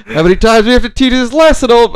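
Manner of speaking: high, fast, strained cartoon fish yell; SpongeBob fish impression. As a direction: belt it like the angry cartoon fish. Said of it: "my impression of that fish from SpongeBob".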